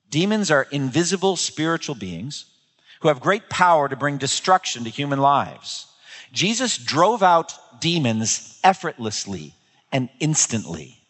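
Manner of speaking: ordinary talk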